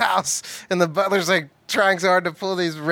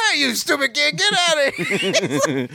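grumpy butler; 10 semitones higher than usual. Retold or imitated. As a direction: chase the kid off in a put-on voice. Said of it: "He's like".

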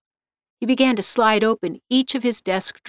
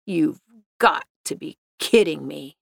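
lower, grumbling growl of disbelief; muttered growl at a stuck window; 5 semitones lower than usual. A narrator's reading. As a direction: growl it under your breath, fed up. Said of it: "he growled".

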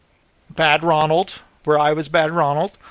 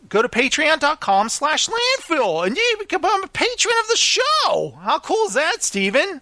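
much higher, voice running away; voice going crazy; 7 semitones higher than usual. Real, not acted. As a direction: pitch climbing way up. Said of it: "My voice went kind of crazy there".

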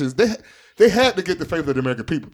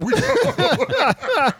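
laughing mock Biden.

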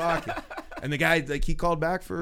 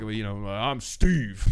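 coworker's alias; 4 semitones lower than usual. Retold or imitated. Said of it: "Matt's always like".

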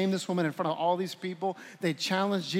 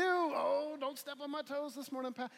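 mock churchgoer; 7 semitones higher, 11 dB quieter than usual. Mocking churchgoer's complaint.

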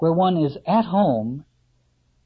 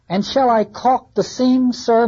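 higher, louder eager carpenter; carpenter asking; 8 semitones higher, 6 dB louder than usual. Acted. Carpenter asking Ahab about the lid, eager and loud. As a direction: ask the captain keenly, hammer in hand.

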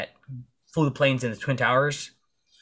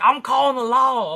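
high mock voice of the irate lady; angry lady threat; 12 semitones higher, 6 dB louder than usual. Landowner threatening the trespasser, high mock voice. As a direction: snap a threat in her voice. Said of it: "some lady comes out speeding".

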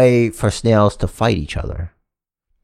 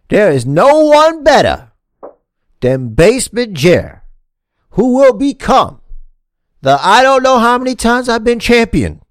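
wrestling promo; 6 semitones higher than usual. Pretend. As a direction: hype yourself up like a heel on the mic. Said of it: "I'm gonna cut a promo on you".

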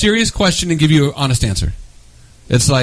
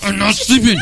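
mock grumpy denial; 7 semitones higher than usual. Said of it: "I'll get so angry and mad".

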